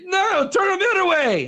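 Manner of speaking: impatient coach correcting a bad wrestler; upset coach. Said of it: "he's getting all upset".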